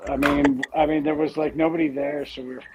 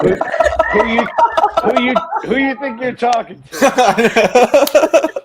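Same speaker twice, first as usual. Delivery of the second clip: loud, slow, high challenge; mock tough guy; 13 semitones higher, 12 dB louder, slower than usual.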